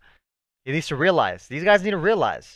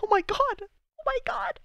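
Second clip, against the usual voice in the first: very high, thin-voiced amazement; overwhelmed squeal; 5 dB quieter than usual. Real, not acted. Fan overwhelmed by the hero's return, high squeal. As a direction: squeak it out, softer on the repeat.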